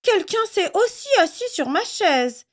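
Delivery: storybook mama bear, soft and high; Mama Bear's soft voice. A narrator's reading. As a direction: ask softly like a bear mother. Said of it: "dit Maman Ours de sa voix douce".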